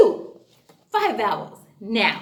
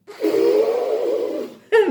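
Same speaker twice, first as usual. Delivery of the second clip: elephant trumpet; 4 dB louder than usual. Shown as a demonstration. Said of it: "That's right".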